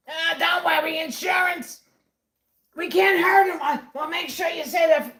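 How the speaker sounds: high, loud put-on voice; exaggerated skit yell; mock shouting. Acted shouting in mocking skit.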